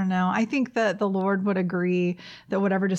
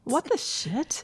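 drawn-out, softer repeat; savoured swear; 5 semitones higher, 4 dB quieter, slower than usual. Shown as a demonstration. Repeating the swear for her co-host, drawn out. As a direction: lean into it comically. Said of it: "I think it sounds hilarious".

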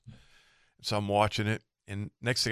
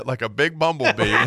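usual speaking voice

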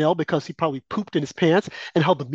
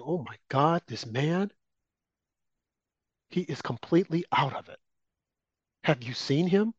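hushed gossip; 7 dB quieter than usual. Retold or imitated. Quoting leaders gossiping about Biden.

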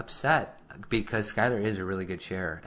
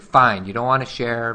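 retold exasperation; 3 semitones higher, 8 dB louder than usual. Retold parental quarrel over sharing.

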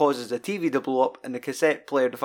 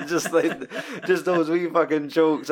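chuckling through the words, a little higher; laughing; 4 semitones higher than usual. Real, not acted. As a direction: laugh at the joke as you talk. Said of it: "I got a chuckle".

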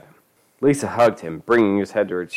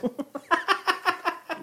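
high, quiet fit of giggles; laughter; 6 dB quieter than usual. Real, not acted. Reader amused by the absurd story, high quiet giggling.

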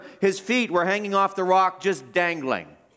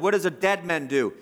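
usual speaking voice

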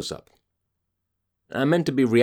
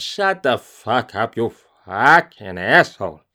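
slow then louder snarl; angry outburst; 4 dB louder than usual. Real, not acted. Host cursing at the singing co-star, angry. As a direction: spit it out at him. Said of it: "got me so angry".

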